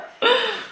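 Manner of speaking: high sharp gasp of mock shock; playful high gasp. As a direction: gasp as if stunned.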